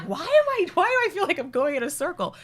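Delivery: mock bewildered passenger; confused traveler. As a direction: play a lost traveler wondering aloud. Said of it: "they're like".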